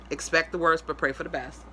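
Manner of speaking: usual speaking voice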